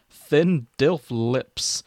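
ordinary talk, nothing marked

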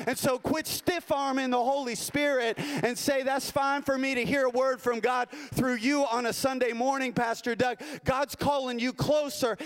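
preaching with growing intensity, pitch climbing; rising preaching. Acted. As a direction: push the congregation closer, building up. Preacher building to exhortation.

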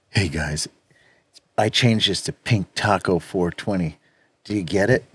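low, soft, smooth come-on voice; sultry voice. Retold or imitated. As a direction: croon it low and seductive. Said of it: "too much credit with that impersonation".

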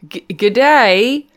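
playful South African take on a greeting; mock South African accent. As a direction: try a South African twist on the Aussie line, slowly. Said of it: "South African shrimp".

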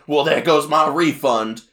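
slow mock grumble over lost money; King Dedede. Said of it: "he specifically said".

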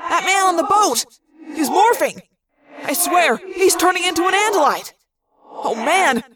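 disbelieving urgent report; echoing excited yell; Marco. A narrator's reading. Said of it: "Marco yelled".

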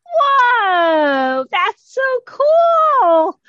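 high, drawn-out amazement ending loud; awestruck wonder. Acted. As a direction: marvel wide-eyed at a light show. Troll dazzled by lights.

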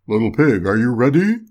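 big wolf at the door, forceful; wolf calling. A narrator's reading. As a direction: call out to the pig as the storybook wolf. Wolf calls for the pig.

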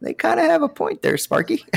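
high, sing-song patronizing voice, laughing; mock condescending reply. Mocking a complainer with a high patronizing voice.

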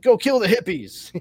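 high, loud comic command; loud, gleeful paraphrase of an order; mock battle order. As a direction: shout it like a hammed-up rallying cry. Blunt paraphrase of order to general.

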